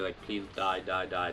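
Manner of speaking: ordinary talk, nothing marked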